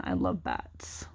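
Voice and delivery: breathy whispered delight; hushed fangirl whisper; excited whisper. Real, not acted. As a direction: whisper it like a secret thrill. Delighted aside over bat card.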